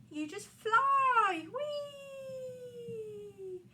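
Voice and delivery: very loud, slow "You just fly" then a long high "Whee"; gleeful flying whoop. Acted. Peter showing Wendy how to fly, whooping loudly. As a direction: swoop up into the air with it.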